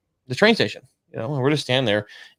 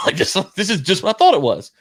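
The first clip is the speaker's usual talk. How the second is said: laughing; 6 semitones higher than usual. Real, not acted. Laughs at the shakedown.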